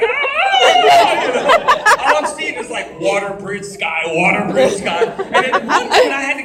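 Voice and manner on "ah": loud, high falling scream; yelling scream. Retold or imitated. Jumper flipping out of control off the bridge, high mock scream. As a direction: re-enact a long high yell mid-tumble. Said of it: "I just start yelling".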